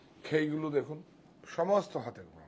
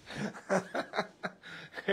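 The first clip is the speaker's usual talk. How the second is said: breathy burst of laughter; amused unvoiced laugh; 6 semitones higher than usual. Real, not acted. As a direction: laugh off the question. Laughing at viewer question.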